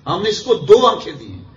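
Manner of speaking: high, loud, emphatic declaration; impassioned preaching. Real, not acted. Preacher pressing the verse's meaning on listeners, impassioned and loud. Same translation, repeated with fervour.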